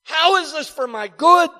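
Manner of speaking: high, loud pleading cry to the Lord; crying out to heaven. Retold or imitated. Preacher retelling his prayer, crying out loud to the Lord. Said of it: "I've cried out to heaven and said".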